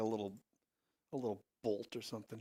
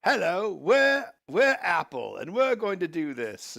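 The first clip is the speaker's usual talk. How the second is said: mock the odd accent of a YouTuber, hammed-up and loud; exaggerated weird-accent impression of a YouTuber; 6 semitones higher, 10 dB louder than usual. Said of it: "the weird accent where he's like".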